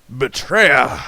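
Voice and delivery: loud dramatic accusation; hammed-up cry of betrayal; mock outrage. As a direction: shout it like a wronged hero. Scolding a game citizen.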